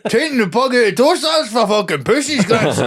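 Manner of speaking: high, laughing mock old man's boast; lightly voiced grandson mocking caution; mock granddad. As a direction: ham up the granddad's scoffing. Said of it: "That's what the granddad said".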